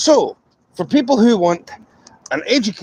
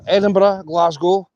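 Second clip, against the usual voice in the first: ordinary delivery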